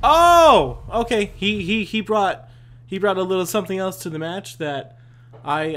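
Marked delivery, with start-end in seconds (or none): 0.0-0.8 long, loud, high "oh"; shocked shout. Real, not acted. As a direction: cry out at a sudden death. Gets killed in game.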